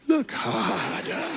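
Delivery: high, soft, very drawn-out sage voice; Rafiki. Acted. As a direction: stretch it out high and hushed like a mysterious old sage. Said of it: "And Rafiki says".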